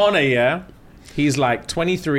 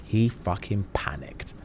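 voiceless, dramatic near-whisper; hushed reveal; 4 semitones lower, 8 dB quieter, slower than usual. Real, not acted. Telling friend about the match, hushed whisper. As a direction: drop to a whisper for the shock.

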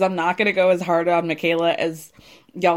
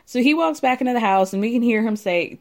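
ordinary talk, nothing marked

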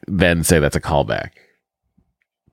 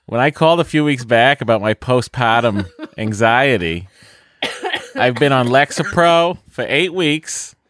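higher, exaggerated Boston accent; 6 semitones higher than usual. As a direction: read it like a broad Bostonian. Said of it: "the accent from my, uh, our home".

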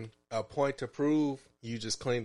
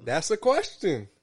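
high insistent; 7 semitones higher, 6 dB louder than usual. Real, not acted. Insisting it's a question.

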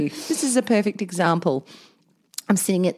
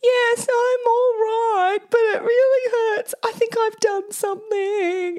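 much higher, slow hammed-up wounded whimper; milk it like a pitiful patient; feeble pained voice; 13 semitones higher, slower than usual. Hurt wife calling to husband on speaker, much higher hammed-up whimper. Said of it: "my voice from the background goes".

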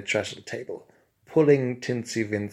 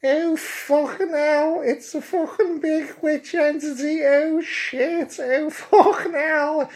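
very high, loud hysterical shrieking; panicked freak-out; 5 dB louder, slower than usual. Acted. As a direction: lose it completely in a piercing, drawn-out panic. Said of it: "he lost his shit right from the off".

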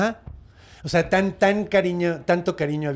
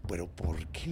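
hushed, breathless asking why; whispered question; 11 semitones lower, 13 dB quieter than usual. Retold or imitated. Gilgamesh asking Enkidu why, in a whisper. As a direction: whisper it like a timid sidekick.

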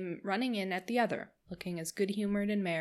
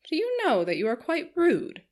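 gushing, higher society lady, talking on and on; chattering Missus Palmer; 8 semitones higher, 6 dB louder than usual. Acted. Missus Palmer gushing to the Dashwood sisters, higher and eager. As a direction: bubble over like a giddy hostess.

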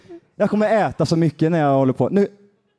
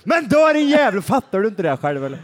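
mock outburst, much higher on "dö"; furious relative; 3 semitones higher than usual. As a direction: snap it out at the dying man, fed up. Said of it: "Man är skitarg". (spelad ilska, ljust och skarpt på "dö")

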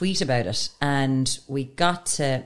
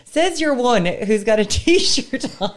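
ordinary talk

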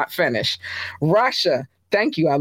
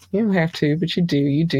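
ordinary delivery

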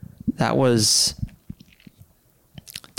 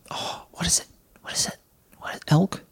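whisper; 5 semitones higher, 6 dB quieter, faster than usual. Real, not acted. Searching memory for animal name.

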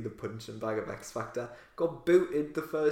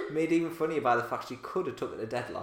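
ordinary talk

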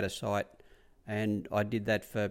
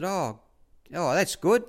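high, louder imitation of a trainer; Bart; 8 semitones higher, 4 dB louder, slower than usual. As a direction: answer in a put-on raised voice. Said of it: "He said".

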